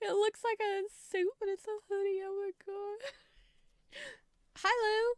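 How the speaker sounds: high, excited squeal; delighted squeal. Real, not acted. Gushing over suit hoodie.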